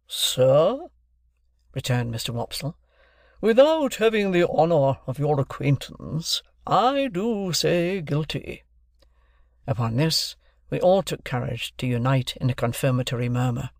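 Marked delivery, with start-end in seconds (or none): 0.1-8.6 slow, raised, stately declaiming; pompous stage voice. Acted. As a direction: puff up like an old actor. Wopsle answers with pomp.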